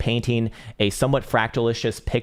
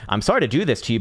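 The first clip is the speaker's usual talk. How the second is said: ordinary talk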